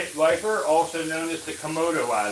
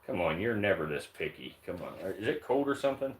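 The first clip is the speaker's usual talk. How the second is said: softer, lower sweet-talk to the snake; murmuring to a picky snake at feeding time; soft coaxing to a pet; 6 semitones lower, 9 dB quieter, faster than usual. Real, not acted. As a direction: coax her gently to take the food. Coaxing female snake to eat.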